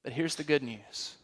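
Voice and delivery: hushed announcement of good news; quiet lead-in. Shown as a demonstration. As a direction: draw them in gently before the news. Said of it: "This is what Paul delighted to proclaim".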